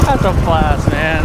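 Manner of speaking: shouting over wind. Real, not acted.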